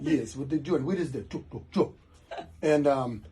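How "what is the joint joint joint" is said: put-on the chef's question again in a light French accent; mock French accent. Showing the hosts how his chef talked, a light mock French accent. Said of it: "That's how he spoke".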